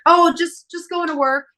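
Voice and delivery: high, loud chirpy fib, fading as it goes; high innocent voice of a daughter brushing off her dad; fake innocent. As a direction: act breezy and caught out, innocent lie. Lying to dad on phone.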